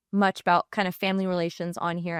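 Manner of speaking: ordinary talk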